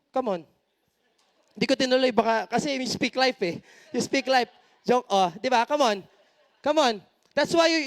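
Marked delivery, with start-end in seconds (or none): none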